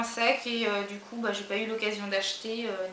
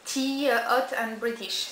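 slow, careful English read with a French accent; 3 dB louder, much slower than usual. Real, not acted. Vlogger reading an English quote to viewers, slow French-accented English. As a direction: spell out the English card word by word in a French accent. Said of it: "désolée pour mon anglais".

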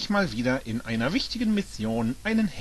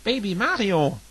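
high, louder surprised exclaim; 8 semitones higher, 4 dB louder than usual. A narrator's reading. Toad recognising Baby Mario, surprised. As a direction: blurt it out in surprise. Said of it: "entgegnete Toad überrascht".

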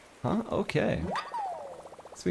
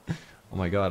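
usual speaking voice

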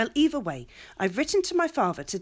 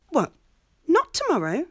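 dismayed surprise; 8 semitones higher, 4 dB louder than usual. Acted. Marcia learns the wedding is delayed.

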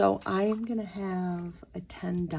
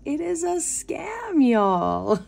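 much higher, louder warning; emphatic warning; 9 semitones higher, 8 dB louder, slower than usual. Real, not acted. Warning her viewers about scam texts, loud and emphatic. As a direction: hammer the point home to the viewers.

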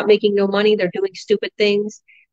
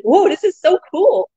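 excited whoa; 10 semitones higher, 3 dB louder than usual. Retold or imitated. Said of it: "I was like".

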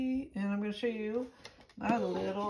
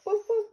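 much higher, louder playful woof; dog barks; 13 semitones higher, 6 dB louder than usual. Pretend. Mother barks toy dog for baby, high playful woofs. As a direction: bark like a puppy for the baby.

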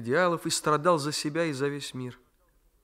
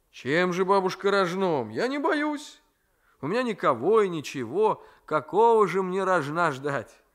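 higher and a bit louder, challenging; a nephew daring fate to strike; higher, louder, careless defiance; defiant young man; 5 semitones higher, 4 dB louder than usual. Acted. Raisky defiant.

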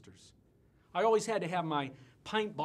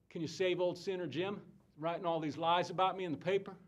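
ordinary talk

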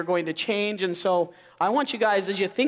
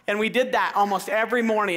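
normal talking voice

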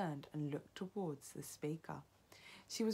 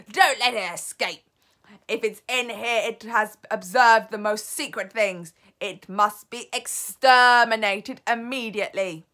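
loud, raised, imperious orders; screeched command; 5 semitones higher, 16 dB louder than usual. A narrator's reading. Grand High Witch ordering the spy exterminated, screeching. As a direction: drive each order home at full force. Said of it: "screeched the Grand High Witch".

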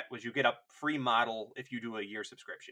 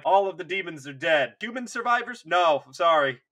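loud, higher-pitched soldier radioing in; loud mock field report; walkie-talkie report; 6 semitones higher, 9 dB louder than usual. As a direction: report over the radio, hammed-up. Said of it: "get on the walkie-talkie like".

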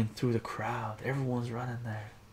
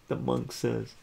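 ordinary delivery, nothing marked

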